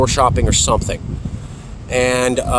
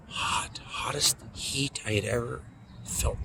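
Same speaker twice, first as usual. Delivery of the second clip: whispered, much softer, remembering the burn; hushed whisper; 15 dB quieter than usual. Retold or imitated. Reliving the burn on his shoulder, near whisper. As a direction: breathe it out as if reliving pain.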